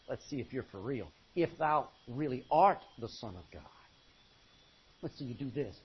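lightly voiced devil, low and quiet; devil's challenge. Devil testing Jesus in the wilderness, low quiet challenge. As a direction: quietly dare him to prove it.

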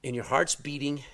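ordinary talk, nothing marked

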